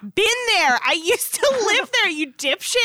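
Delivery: high, loud teasing outrage; indignant retort. Real, not acted. Cohost who lived in Jersey loudly teasing her friend. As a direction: defend New Jersey with playful indignation.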